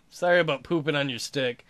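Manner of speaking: ordinary talk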